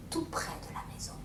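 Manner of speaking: hushed storyteller whisper; breathless suspense whisper. A narrator's reading. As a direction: whisper it like a secret drawing near. Suspense as ogre approaches.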